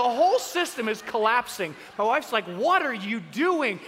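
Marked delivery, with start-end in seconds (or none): 2.4-3.8 loud, slow, raised demand; acted indignant scolding; exasperated wife. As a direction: stretch each word in outrage.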